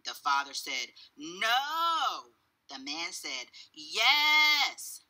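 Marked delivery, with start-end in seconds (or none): 1.2-2.3 high, stretched-out no; stubborn refusal. A narrator's reading. Father keeps arguing with the cashier, stubborn. 3.7-5.0 high, loud, stretched yes; stubborn insistence. A narrator's reading. Cashier keeps contradicting the father, insistent.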